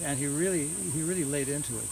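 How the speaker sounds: ordinary delivery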